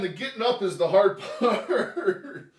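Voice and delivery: slow, laughing through the strain. Real, not acted. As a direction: laugh while heaving up. Getting up from floor.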